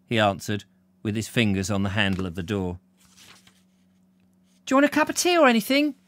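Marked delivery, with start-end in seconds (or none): 4.6-5.9 very high, fast mum voice; mum's voice. Acted. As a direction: play a mother calling an offer, pitched right up. Voices Percy's mum calling to him.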